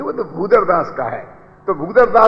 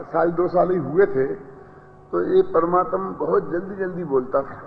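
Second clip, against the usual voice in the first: normal talking voice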